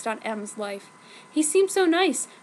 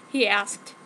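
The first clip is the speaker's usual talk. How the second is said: ordinary delivery, nothing marked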